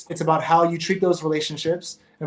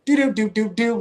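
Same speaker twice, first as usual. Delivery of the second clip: high sung-along key notes, mimicking a piano; hummed piano notes; 5 semitones higher than usual. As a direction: hum the warning notes like keys being tapped. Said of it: "play a little note on the key".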